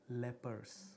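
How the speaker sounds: usual speaking voice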